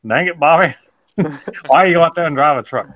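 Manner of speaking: high-pitched cartoon dad scolding; King of the Hill impression. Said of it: "from King of the Hill".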